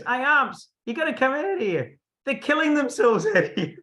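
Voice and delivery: very high, frantic retold shout; acted-out panicky yell for help; urgent calling out. Man banging on door, calling.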